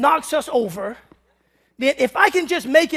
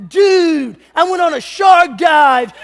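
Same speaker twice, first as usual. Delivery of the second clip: louder, higher bro shout; mock surfer dude; 4 semitones higher, 7 dB louder, slower than usual. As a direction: ham up a hyped beach dude. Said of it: "a brother talking about".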